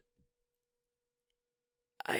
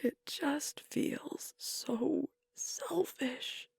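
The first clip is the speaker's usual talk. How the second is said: very slow, higher and halting; grieving self-reproach; 5 semitones higher, 4 dB quieter, much slower than usual. Acted. Alpha grieving a change, slow guilty confession. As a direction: let it out slowly, pained and unsure. Grieving a friend moving away.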